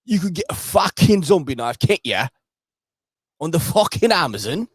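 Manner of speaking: exaggerated British impression, indignant and punchy; standard British accent. Streamer parodying the actor on zombie knives, in a hammed-up British accent. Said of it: "my standard, uh, British accent".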